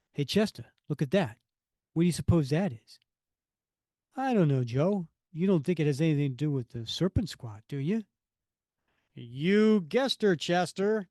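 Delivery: projected, acted working-man chatter; comic-book worker dialogue. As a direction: play two startled rig hands, punching the lines. Voices two oil rig workers.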